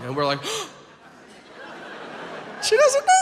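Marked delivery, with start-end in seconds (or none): 1.6-2.6 soft, unvoiced acted gasp; mock gasp. Class reacts to the substitute's question. 2.6-3.1 very high squeal of glee; hammed-up, high-pitched excitement; mock thrilled squeal. Thrilled kids realize teacher is unaware.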